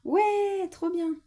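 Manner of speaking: put-on a kid thrilled by the news; excited cheer. Pupil cheering about jumping lesson. (ravi, tout excité)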